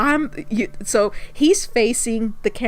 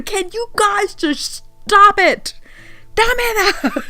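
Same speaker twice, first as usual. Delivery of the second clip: retold yell; 10 semitones higher, slower than usual. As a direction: yell at them to stop, laughing. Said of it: "the guy starts yelling".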